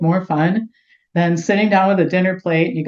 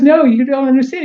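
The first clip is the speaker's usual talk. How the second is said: excited insistence; 8 semitones higher, 7 dB louder than usual. Retold or imitated. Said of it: "he goes".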